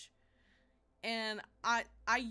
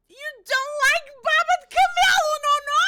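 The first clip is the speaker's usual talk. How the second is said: much higher, much louder incredulous shriek; real scream; 19 dB louder, faster than usual. Real, not acted. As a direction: scream it at a friend in disbelief. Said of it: "I'm screaming in your ear".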